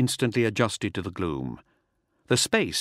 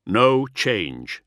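firm, louder order with mild force; understated command, louder than the narration; 5 dB louder than usual. A narrator's reading. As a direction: give a decisive order from the bridge. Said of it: "he commanded".

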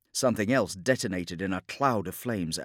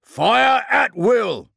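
drawn-out, very high battle shout; full-force command to open fire; bellowed order; 10 dB louder, much slower than usual. Acted. Captain unleashing his gunners, bellowed order. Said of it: "ordered Dzansk".

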